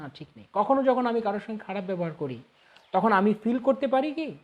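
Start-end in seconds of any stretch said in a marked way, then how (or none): 0.0-0.5 very soft, lower inner voice; quiet voice of the intellect; voice of conscience. Acted. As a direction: drop right down, a hushed correction from within. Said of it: "আমার বিবেক কিন্তু আমাকে বলছে".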